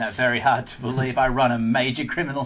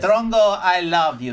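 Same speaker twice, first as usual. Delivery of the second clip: louder, higher copy of his voice; mimicking his voice; 4 semitones higher, 4 dB louder than usual. Shown as a demonstration. Father repeating the line in the friend's voice. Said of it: "Repeat it in my voice".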